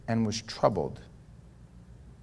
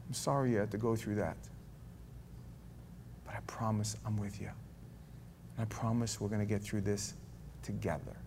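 much softer, tender reassurance; gentle comfort; 3 semitones lower, 12 dB quieter than usual. Retold or imitated. Preacher voices God consoling someone in grief, soft and tender. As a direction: hold them close with quiet words.

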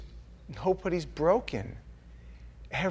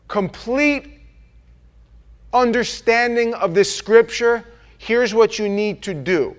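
loud, raised preaching voice; preaching at full force; 5 semitones higher, 8 dB louder than usual. Real, not acted. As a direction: drive the point home to the congregation.

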